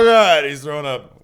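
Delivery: high, loud teasing cry; loud mock alarm. Real, not acted. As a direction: yell it out to everyone, teasing. Co-host choking, teasing alarm.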